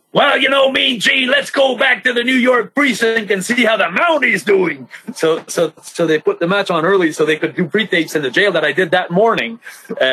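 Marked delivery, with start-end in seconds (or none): 0.1-4.8 lampoon the commentator tossing back to the precinct; imitating Jesse Ventura. Wrestler mimics Jesse Ventura tossing to the precinct, high mock announcer. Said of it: "Jesse the Body Ventura would say something like".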